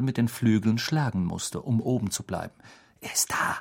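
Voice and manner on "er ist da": whispering. Acted. Hiding outside the window.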